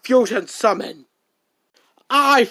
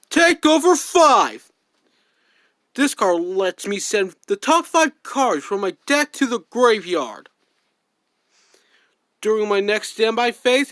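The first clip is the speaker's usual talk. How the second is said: usual speaking voice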